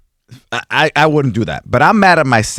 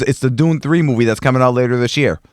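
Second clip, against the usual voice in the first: normal talking voice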